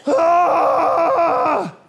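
sudden high loud grunt of effort; grunting yell. Retold or imitated. Gym guy doing squats by the mirror: loud strained yell. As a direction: bellow like lifting a heavy squat. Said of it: "And he's".

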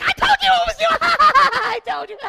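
shrill-voiced triumphant gloat; gloating Heather impression. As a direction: crow over being right, laughing. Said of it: "And Heather's like".

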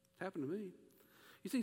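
ordinary talk, nothing marked

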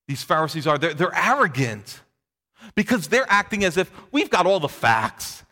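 ordinary talk, nothing marked

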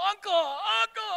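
high, loud, drawn-out mock surrender; hammed-up wail, stretched out; mock cry of uncle. Acting out a Christmas Story bully scene, mock cry of uncle.

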